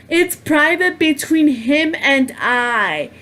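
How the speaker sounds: slow, drawn-out mock quote of a rival; mocking imitation. Retelling the girl's claim about him, mocking. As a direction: stretch each word in mocking imitation.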